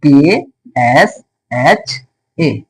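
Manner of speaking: केएसएचए read letter by letter, very slowly; letters spelled out slowly. Shown as a demonstration. Teacher spelling केएसएचए slowly for students. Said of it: "क्या लिखेंगे?".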